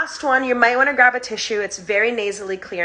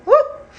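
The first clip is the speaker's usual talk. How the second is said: excited whoop; 6 dB louder than usual. Real, not acted. After a round of breathing.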